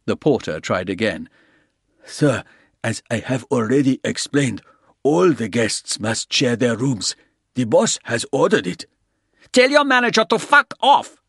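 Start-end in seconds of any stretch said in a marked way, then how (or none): 2.0-8.9 firm porter. A narrator's reading. Porter explaining room rules. 9.5-11.2 put-on high, loud guttural German-accented shout; harsh German accent. A narrator's reading. Old German guest yells at the porter in harsh German accent. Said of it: "harsh, guttural accent, German".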